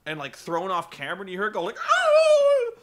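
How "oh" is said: very high, loud pained yelp; dog's yelp. Retold or imitated. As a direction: let out a sharp hurt yelp. Dog hurled across room.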